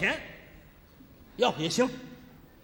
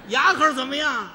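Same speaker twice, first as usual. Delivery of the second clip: loud teasing question; 2 semitones higher, 7 dB louder than usual. Retold or imitated. Young guest teasing old man.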